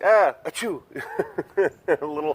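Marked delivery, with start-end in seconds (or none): 0.0-0.8 half-laughed fake sneeze; retelling her own sneeze; laughing mock sneeze. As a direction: sneeze it while chuckling. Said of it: "I'm like".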